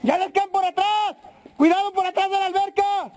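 high, urgent yell to teammates; warning shout. Real, not acted. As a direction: shout the warning across the field. Warning teammates during airsoft fight. (grito de advertencia)

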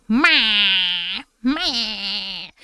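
nasal loud cat bleat, imitated; ugly meow imitation. Streamer imitating her cat's ugliest demanding meow, mock bleat. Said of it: "the ugliest meow".

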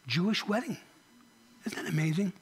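ordinary talk, nothing marked

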